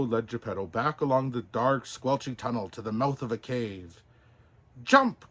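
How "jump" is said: high, loud urgent command; a loud urgent jump; crying out. Acted. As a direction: shout it to make him leap. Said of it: "cried Pinocchio".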